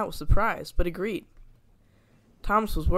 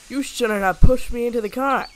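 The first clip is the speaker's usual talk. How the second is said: higher, louder indignant huff; 5 semitones higher, 8 dB louder than usual. A narrator's reading. Donald huffing at Douglas after the crash. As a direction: blame him in a huff. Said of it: "huffed Donald".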